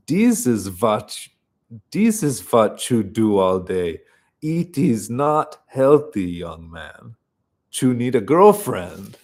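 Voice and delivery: hammed-up French accent, stressed words punched. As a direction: scold in a playful mock French accent. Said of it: "summoning my best French accent".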